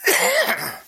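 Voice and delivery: hoarse cough from the yelling; strained cough. Real, not acted. Preacher coughs after straining his voice shouting. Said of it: "That's really hard for me".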